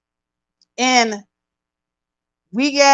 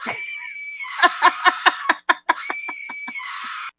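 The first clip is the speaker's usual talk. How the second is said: very high fake wail breaking into sobs; mock bawling; 6 dB quieter than usual. Imitating Kim crying over the new wife, high exaggerated bawl. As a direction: squeal out a hammed-up crying fit. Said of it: "She's like".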